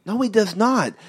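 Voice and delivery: ordinary talk, nothing marked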